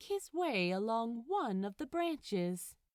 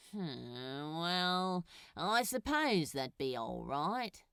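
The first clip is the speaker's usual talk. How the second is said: low, grudging demon, giving in; a hint of a grumpy demon agreeing; lightly voiced sulky Crowley; 3 semitones lower, slower than usual. A narrator's reading. Crowley grudgingly accepting the angel's cocoa, mildly sulky.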